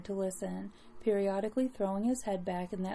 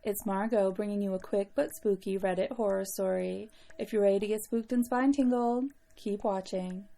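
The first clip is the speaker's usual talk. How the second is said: vintage horror narrator. Acted. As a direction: welcome them in like a horror host. Spooky horror host intro.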